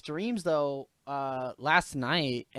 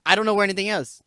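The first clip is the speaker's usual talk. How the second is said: raised, fed-up voice; retold angry yell; 4 semitones higher, 8 dB louder than usual. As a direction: throw the job back at the coworker, loudly. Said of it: "I just yelled".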